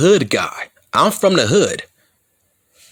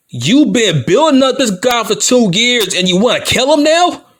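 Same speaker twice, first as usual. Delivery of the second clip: incredulous shout; 7 semitones higher, 3 dB louder than usual. Real, not acted. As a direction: voice jumps high and loud. Heel promo rant peaks.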